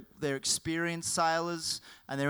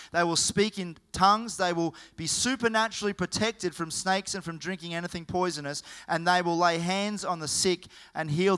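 loud, driving sermon voice; full-force preaching; 4 semitones higher, 6 dB louder than usual. Acted. Pastor rousing the congregation with a loud preaching voice. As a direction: preach it hard to the room.

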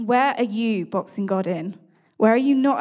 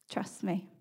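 whispered divine voice; 8 dB quieter than usual. Retold or imitated. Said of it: "the voice then said to me".

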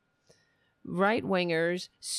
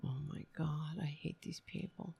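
exasperated whisper; whispered to himself; 5 semitones lower, 14 dB quieter than usual. Real, not acted. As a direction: breathe it out in weary disgust. Disgusted reaction to clip.

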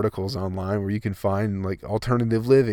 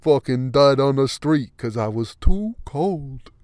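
louder, slow hammed-up tough guy; mock tough guy; 3 semitones higher, 5 dB louder, slower than usual. Vlogger acting a coatless fool who dies, hammed-up defiance. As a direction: play the coatless guy who freezes to death, overdone.

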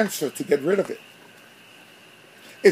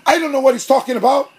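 a higher, louder, hammed-up call to her husband; mock yell across the room; 8 semitones higher, 6 dB louder than usual. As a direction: call out across the church as a puzzled wife. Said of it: "the woman would yell from across the room".

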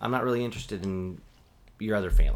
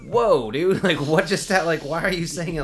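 higher, louder mock shock; incredulous; 8 semitones higher, 9 dB louder than usual. Reacting to classmate's French word, loud mock disbelief.

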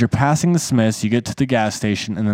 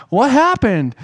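mock confused girlfriend; 11 semitones higher, slower than usual. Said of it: "she's like".

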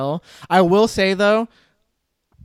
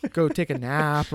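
usual speaking voice

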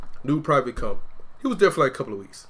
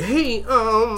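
higher, timid put-on voice; mock tiptoeing hesitance; shriveled voice; 7 semitones higher than usual. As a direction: shrink into a meek little request. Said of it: "that little shrivel in your voice".

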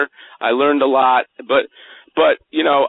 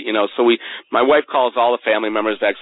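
ordinary talk

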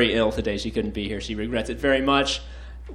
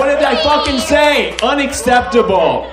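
higher, louder mock scolding; mock outrage; 8 semitones higher, 5 dB louder than usual. Host mock-scolding audience for cheering, mock outrage. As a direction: fake anger at the crowd.

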